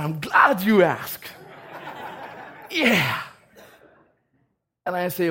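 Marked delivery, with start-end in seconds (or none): 0.0-3.2 louder, slowed reply that breaks into a laugh. Real, not acted. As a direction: laugh at the irony of it. Laughs at his own reply.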